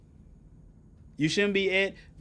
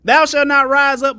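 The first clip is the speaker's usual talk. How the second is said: preaching; 7 semitones higher, 13 dB louder than usual. Acted. Preaching scripture at full force.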